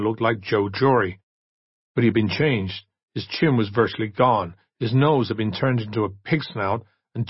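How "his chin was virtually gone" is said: ordinary delivery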